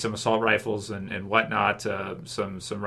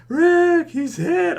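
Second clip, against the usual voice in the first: high, loud alarmed yell; yelling for help from a fallen partner; Shane yelling; 5 dB louder, much slower than usual. Retold or imitated. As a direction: scream your partner's name as he goes down. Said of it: "he yells".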